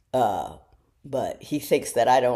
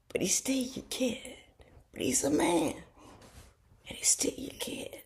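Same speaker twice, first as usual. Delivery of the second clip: soft unvoiced aside about her son; confiding whisper; 8 semitones higher, 9 dB quieter than usual. Real, not acted. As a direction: let it drop to a tender whisper. Mother confiding about grown son.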